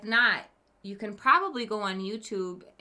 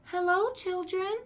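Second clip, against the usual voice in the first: sweeten it up like a kindly teacher; 11 semitones higher than usual. A narrator's reading. Kids hear a sweet voice from the doorway, high and sugary. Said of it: "someone said in a sweet voice".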